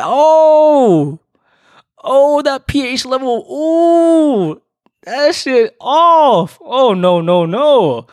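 high, loud squealing disgust, slow; mock horror. To his date about her water, high loud disgusted squeal. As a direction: recoil in exaggerated horror. Reacting to tap water remark.

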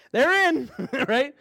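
put-on loud high call of a careless kid; loud carefree kid shout. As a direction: yell it out as you drop the sleds. Kid shouting to dad in basement.

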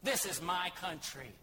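ordinary talk

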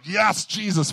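a high mock cry to Jesus; hard worship cry. Preacher imitating a fervent worshipper, high-pitched. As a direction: call out like an intense worshipper. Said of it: "that's your hard worship face".

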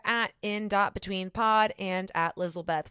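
usual speaking voice

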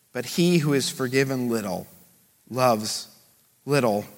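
Jesus gently telling Simon her sins are forgiven; tender pronouncement. Acted. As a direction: let it sink in gently.

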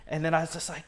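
ordinary talk, nothing marked

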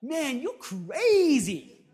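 slow, drawn-out incredulous friend; friend's disbelief. Retold or imitated. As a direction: stretch it out in disbelief. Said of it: "He's like".

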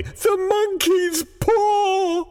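high, drawn-out frantic shriek; slow, wild old woman's screech; wild cry. A narrator's reading. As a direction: cry out wildly, stretching the words. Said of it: "she cried wildly".

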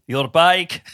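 deadpan, clipped mock Austrian action-hero accent; bad Terminator accent. Imitating a person acting like the Terminator, awful Austrian accent. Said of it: "That's an awful accent".

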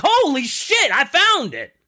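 high, loud burst of triumph; excited discovery. Acted. Researcher finding Glyndor's file, shouting high with excitement. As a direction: shout it like you just struck gold.

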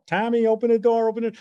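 high, urgent movie-victim imitation; mock desperate plea. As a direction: act out pleading to be let in. Imitating panicked movie plea at door.

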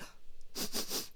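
quiet sniffing of the air; audible sniff. Acted. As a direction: sniff the smell in. Turtle sniffs the smell.

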